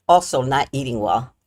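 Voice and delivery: ordinary talk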